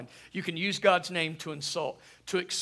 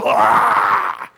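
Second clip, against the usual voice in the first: loud low startled cry; jump-scare yell right after the whisper; sudden shout; 8 semitones lower, 17 dB louder than usual. Retold or imitated.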